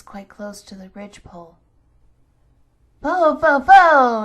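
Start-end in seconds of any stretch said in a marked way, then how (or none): 3.0-4.2 much louder, higher witch's outburst; witch exclaiming. Acted. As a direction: bellow it out as the witch sniffs. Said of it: "exclaimed the witch".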